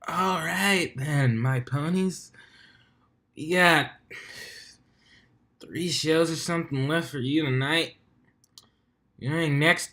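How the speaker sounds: put-on drunk, slow slurred announcing; slurred announcer. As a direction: slur like a tipsy DJ. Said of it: "slurred words".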